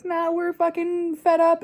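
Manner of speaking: mock Anonymous. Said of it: "Anonymous who's like".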